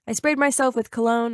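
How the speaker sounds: ordinary delivery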